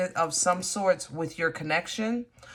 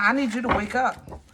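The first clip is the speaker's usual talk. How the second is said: frustrated; 4 semitones higher than usual. Real, not acted. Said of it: "I'm definitely frustrated".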